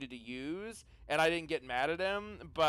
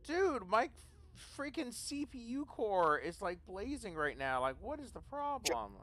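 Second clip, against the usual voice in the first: higher-pitched exasperated complaint; 6 semitones higher, 4 dB quieter than usual. Real, not acted. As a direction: grumble in disbelief at a misbehaving computer. Complains about overheating computer.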